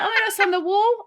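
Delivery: high, fast, flustered alarm; panicked realisation. Retold or imitated. Naked drunk self spotting vomit on the wall, high and rushed. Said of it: "And I was like".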